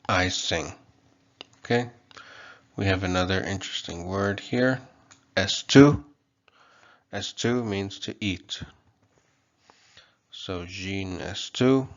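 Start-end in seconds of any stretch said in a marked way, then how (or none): 5.4-6.0 "S two" with a loud, high final "two"; final-syllable stress. Shown as a demonstration. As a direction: punch the last syllable of "S two". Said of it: "stress is on the final syllable".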